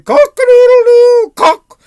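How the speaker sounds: very high, loud drawn-out crow; piercing cock-a-doodle-doo; cockerel crowing. Acted. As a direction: crow at full blast like a rooster. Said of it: "the cockerel started crying".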